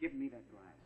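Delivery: soft, steady command. Acted. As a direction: ask for it quietly, firmly. Husband demanding glass quietly.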